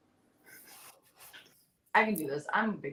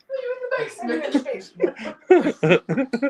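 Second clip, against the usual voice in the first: slow, loud mock English voice; English accent; 6 semitones higher, 14 dB louder, much slower than usual. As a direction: drawl it out in a hammy British accent. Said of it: "Why are you English?".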